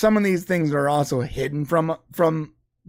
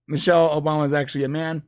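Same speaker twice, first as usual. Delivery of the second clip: usual speaking voice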